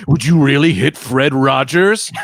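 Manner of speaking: slow, drawn-out pleading in a put-on voice; guilt-trip the attacker slowly; mock plea. Shang Tsung disguised as Fred Rogers, slow mock plea.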